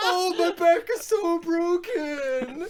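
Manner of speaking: high, slow overdone whine of pain; drama queen moan. Retold or imitated. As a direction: ham up the aching back. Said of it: "such a fucking drama queen".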